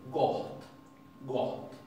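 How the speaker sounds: goht with breathy H before the final T; preaspirated T. Shown as a demonstration. Teacher repeating the example, HT for double T. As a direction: let air out before the T in goht. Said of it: "la doble T es H T".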